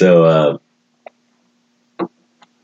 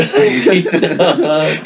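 laughing through the word; 12 semitones higher than usual. Real, not acted. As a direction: giggle out the name. Laughing at the name.

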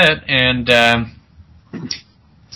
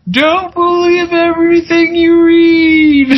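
mock dying scream. Guy taken down by zombies, hammed-up high wail. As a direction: wail it as he goes down. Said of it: "screams out".

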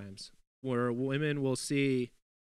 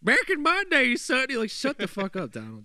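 much higher, louder mock old man; old-timer voice; 12 semitones higher, 8 dB louder than usual. Joking as a cranky old man talking to a youngster. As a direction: ham up a cranky grandpa.

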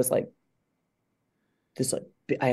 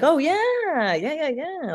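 high, drawn-out relieved cheer; exaggerated high-pitched approval; acted delighted approval; 13 semitones higher, much slower than usual. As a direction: swoop high on a long pleased yeah. Acted approval at improved game.

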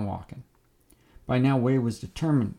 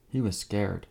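ordinary delivery, nothing marked

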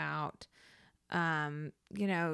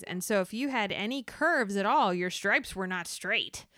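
ordinary delivery

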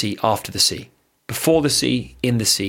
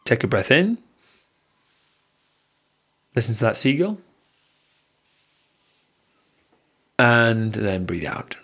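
guided breathing. Acted. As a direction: talk them through each breath, unhurried. Leads a breathing exercise.

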